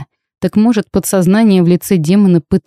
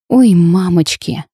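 moaning; slower than usual. A narrator's reading. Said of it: "и застонала".

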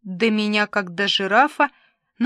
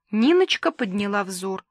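ordinary delivery

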